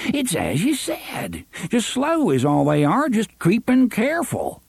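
lightly voiced folksy sheriff.

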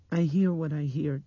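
put-on high, loud child's voice; insistent little girl. A narrator's reading. Blind girl standing by what she hears, insistent.

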